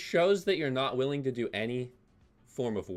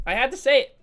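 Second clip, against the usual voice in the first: higher and louder, almost shouted; louder, defiant second take; loud repeat; 10 semitones higher, 8 dB louder, faster than usual. Real, not acted. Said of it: "I'm, I'm sorry".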